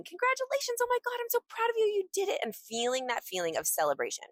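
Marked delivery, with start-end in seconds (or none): 0.0-2.4 squealed congratulations, very high and quick; celebrating friends. Acted. Friends at the door celebrating her, high excited gushing. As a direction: cheer like a proud friend bursting with excitement. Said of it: "just celebrating me and saying".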